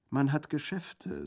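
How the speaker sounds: put-on high, slow, cheerful girl; lightly voiced merry young woman. A narrator's reading. Girl answering the bandit's remark, cheerful. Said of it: "sagte das junge Mädchen frohgelaunt".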